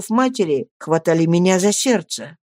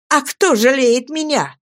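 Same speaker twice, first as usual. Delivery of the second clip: higher, cutting reply; sharp retort; 7 semitones higher than usual. A narrator's reading. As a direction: snap back bitterly. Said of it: "резко отозвалась она".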